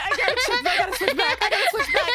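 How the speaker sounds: high, loud laughing protest. Real, not acted. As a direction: laugh through every word. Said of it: "It's consuming me".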